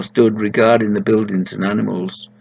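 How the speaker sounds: ordinary delivery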